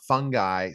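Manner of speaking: "fungi" said as FUN-guy; hard G pronunciation. Shown as a demonstration. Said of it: "Some people say it fungi and fungi".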